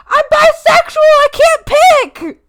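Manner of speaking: high, loud protest; mock-indignant yell. Streamer defends themselves to teasing chat, mock-indignant yell. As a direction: yell it in exasperation, stretching the end. Protests at flip-flopping accusation.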